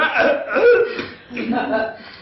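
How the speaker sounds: coughing fit mid-proposal; sputtering choke on a word; choking cough. Acted. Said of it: "something stuck in my throat".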